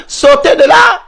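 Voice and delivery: high-pitched, exasperated order; urgent demand. Real, not acted. Urging the dialogue delegates to leave, urgent demand. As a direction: urge them out, voice jumping up.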